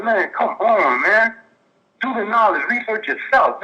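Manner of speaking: high, slow, pleading "come on, man"; mock-beg them to wise up; exasperated plea. Caller replays his plea to the misled doubters. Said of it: "I'm like".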